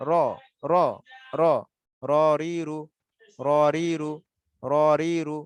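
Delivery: "র রি রু" drilled with a full, thick R; thick, heavy R. Shown as a demonstration. Teacher drilling ra for the class, thick heavy R. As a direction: curl the tongue and make every "র" heavy. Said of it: "হরফটা মোটা হবে".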